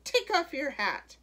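insistent yell; crowd shouting. A narrator's reading. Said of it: "the crowd shouted at Miss Honeycutt".

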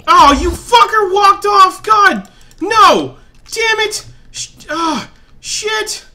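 very high-pitched angry yelling; real exasperated rage; furious outburst. Real, not acted. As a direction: scream curses as the plan escapes. Trapped zombie wandered off, player rages.

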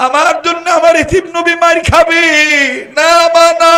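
high, loud sobbing cry, building; wailing shout. Acted. Preacher cries out the son's fear for the Prophet, loud and high. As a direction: wail and shout the fear at full force.

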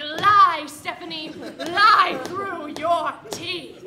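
loud, slow, dramatic command; mother's voice cracking. Retold or imitated. Imagined mother urging her to lie, loud and slow. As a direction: boom it from the shadows of her mind. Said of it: "my mother's voice crack from the shadows".

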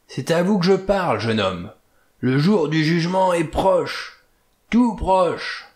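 high, loud, slow proclamation of doom; dignified drunk prophet. A narrator's reading. Old sailor sprawled on platform warns Blore, solemn. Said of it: "déclara avec une incommensurable dignité".

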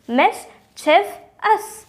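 "Mischievous" broken up as MIS, chuh, vus, very slow; syllable by syllable. Shown as a demonstration. Teacher breaking the word down, syllable by syllable. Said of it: "with just three syllable".

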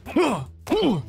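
high, short attack huffs; effort grunts. Real, not acted. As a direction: huff with each swing. Attack noises during game fight.

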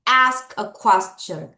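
very slow, every word spaced out; slow model phrase. Shown as a demonstration. Teacher modelling a phrase slowly for pupils to repeat. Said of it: "다시".

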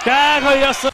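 loud, high reaction shout; excited exclamation. Real, not acted. Commentator reacting to a netted ball, loud excited cry.

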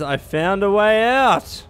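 high, drawn-out cry of discovery; triumphant exclamation. Real, not acted. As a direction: stretch it out in triumph. Triumph at finding exit.

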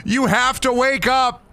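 high, stretched-out hammed-up farewell; melodramatic hero. Hero leaving for their people, in mock melodrama. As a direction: call out slowly with mock grandeur.

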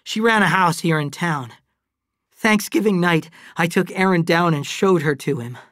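bitter confession, pitched a little high. A narrator's reading. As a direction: spit out the ugly family secret, firmly. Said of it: "said Cal bitterly".

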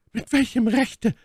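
high, indignant lady's cry; indignant challenge. Acted. Milady defies the guards. (hohe, empörte Frauenstimme)

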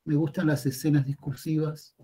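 ordinary talk

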